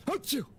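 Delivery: loud, high-pitched sneeze. Acted. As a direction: let out a big sneeze. Character sneezes.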